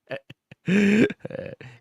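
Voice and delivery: loud, high burst of laughter; laughing. Real, not acted. Commentator cracking up at a listener's drenched boxers joke, laughing.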